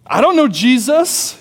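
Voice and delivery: insistent denial, lifted at the end; emphatic retold denial. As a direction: act out Peter insisting he doesn't. Repeats Peter's denial more forcefully.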